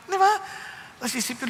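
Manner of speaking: normal talking voice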